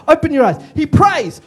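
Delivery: normal talking voice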